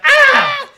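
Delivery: high, loud yelped scream; imitated scream. Co-host mimicking Poindexter's startled scream, loud mock scream. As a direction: let out a sudden piercing mock scream. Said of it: "screams ... He's like".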